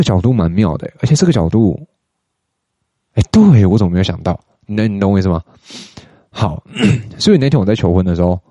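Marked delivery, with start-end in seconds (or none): 3.1-3.7 louder, higher excited outburst; excited aha. Real, not acted. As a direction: exclaim as it clicks. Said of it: "我豁然开朗欸". (兴奋地大声喊出)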